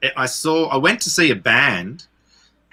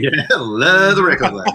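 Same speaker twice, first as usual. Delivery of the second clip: sarcastic laugh; 7 semitones higher, 4 dB louder than usual. Real, not acted. Mocking the record label.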